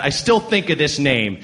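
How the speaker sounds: ordinary talk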